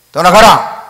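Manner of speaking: 떠나가라! thrown out loud, falling; mock exorcism shout. Friend ordering the demon out, a retold loud command.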